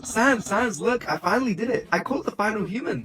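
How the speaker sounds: bouncing with pride over the catch; excited Papyrus. Acted. Papyrus proudly tells Sans he caught the human. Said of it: "Papyrus very happy with this".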